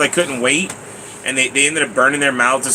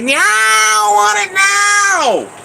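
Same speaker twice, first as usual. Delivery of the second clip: put-on high, loud and slow, a childish greedy yell; greedy inner fat kid; 5 dB louder, much slower than usual. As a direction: let the hungry kid inside scream for it, stretched out. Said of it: "the fat kid inside of me go like".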